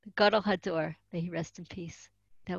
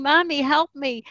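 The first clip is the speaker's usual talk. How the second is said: raised, higher mock cry for help; retold yell; 6 semitones higher, 5 dB louder than usual. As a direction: voice the girls calling out, a bit louder. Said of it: "and they were yelling".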